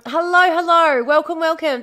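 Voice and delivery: cheery welcome. Real, not acted. Welcoming new viewers to stream.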